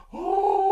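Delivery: high, loud humming "oh"; refrigerator hum. Retold or imitated. Storyteller mimicking the fridge in the dark kitchen, high hum. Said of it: "hear the refrigerator going".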